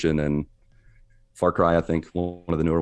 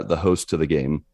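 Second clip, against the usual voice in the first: usual speaking voice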